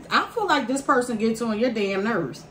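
ordinary talk

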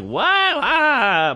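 very high, retold amazed wail; high-pitched dazed exclamation; head spinning. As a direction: reel in mock overwhelm. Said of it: "my head's spinning".

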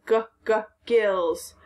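"gills" with the hard g sounded out first; sounding out hard g. Shown as a demonstration. Teacher sounding out the hard g in "gills" for students. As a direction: tap out the g, then say "gills".

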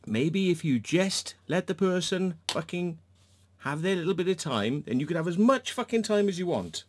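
high, exasperated lecturing; exasperated rant. Real, not acted. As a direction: let the frustration spill out. Ranting at the other dog owner.

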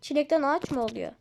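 normal talking voice

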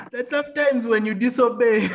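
amused confession breaking into laughter; laughing. Real, not acted. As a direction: say it through a real laugh. Laughing at his own lesson.

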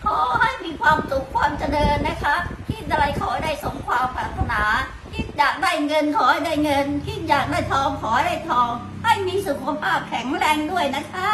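cheerful birthday blessing. Real, not acted.

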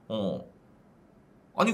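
ordinary delivery

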